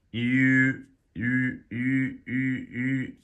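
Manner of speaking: U blended from ee and oo; repeated high, level U, an ee with rounded lips; umlaut U sound. Shown as a demonstration. Teacher combining E and oo for learners, rounded U. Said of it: "start out with an E and you go to an oo".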